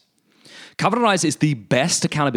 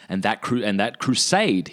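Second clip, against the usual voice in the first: ordinary talk, nothing marked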